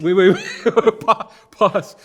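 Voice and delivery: laughing, high and loud interruption; mock alarm. Founder halting a company's boast, in laughing mock alarm. Acted alarm at absurd claim.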